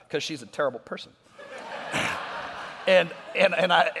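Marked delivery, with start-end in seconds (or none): 1.4-2.8 a soft, silent chuckle; breathy laugh. Real, not acted. Pastor laughing at his fiancée's jab, soft breathy laugh. 2.9-3.9 laughing. Real, not acted. Laughing while telling story.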